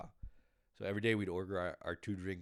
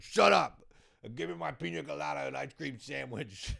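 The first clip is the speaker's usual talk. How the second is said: hammed-up shout, much higher and louder, fading down; mock yell; 7 semitones higher, 9 dB louder than usual. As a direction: blast it at them, then grumble the order. Mock reply to judging staff.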